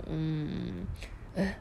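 plain reading voice

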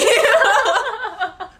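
high, loud burst of laughter. Real, not acted. Friend laughing while retelling the guard story, loud laugh.

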